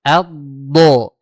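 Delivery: Ad, do. said loud and joined; tajwid drill. Shown as a demonstration. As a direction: recite Ad, do. without a break. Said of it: "Jangan terputus". (latihan tajwid dialirkan)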